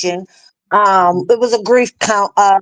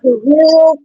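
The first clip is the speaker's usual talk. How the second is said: startled for real; 6 semitones higher, 4 dB louder than usual. Retold or imitated. Reacting to Marnie's warning.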